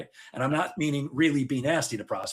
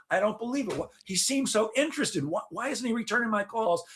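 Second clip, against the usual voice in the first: higher, mock baffled seller; puzzled disbelief; 7 semitones higher than usual. A seller baffled by unreturned calls, mock bewildered and high. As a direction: ham up the confused salesman wondering why. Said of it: "people are scratching their heads going".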